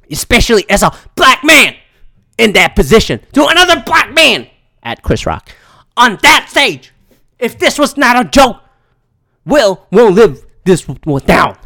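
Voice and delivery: dramatic pundit outrage, loud, punched phrases; Stephen A. Smith. Acted. Pundit scolds Will Smith over Chris Rock, loud and dramatic. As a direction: hold forth emphatically, pausing between punched phrases. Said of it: "dramatic reading that I had to do of Stephen A's Twitter".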